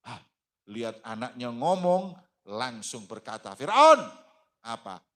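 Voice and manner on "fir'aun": much higher, much louder cry of his name; defiant call. Acted. Masyitoh defiantly calling out to Pharaoh, loud. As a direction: call him out boldly.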